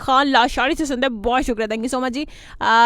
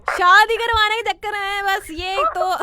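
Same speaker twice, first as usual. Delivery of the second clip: amused disbelieving repeat, pitched up; surprised echo; 8 semitones higher, 4 dB louder, slower than usual. Real, not acted. Host echoes caller, amused. (हैरानी भरी हँसी के साथ दोहराना)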